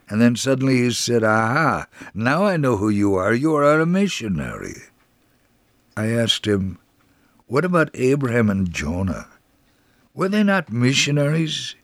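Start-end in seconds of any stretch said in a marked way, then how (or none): none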